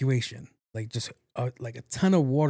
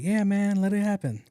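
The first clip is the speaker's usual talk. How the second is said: high, easygoing bro voice; laid-back dude voice; 7 semitones higher than usual. Retold or imitated. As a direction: hype it up like a chill buddy. Buddies cheering him on.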